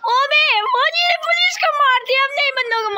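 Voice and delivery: high excited shouts at the soldier; excited yelling. Real, not acted. Gamer shouting at an in-game soldier, excited high yell. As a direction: yelp it out, thrilled by the chaos.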